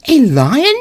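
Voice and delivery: high, sudden squeak of fear; a tiny queen startled by danger; alarmed mouse queen. Acted. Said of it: "cried the little Queen".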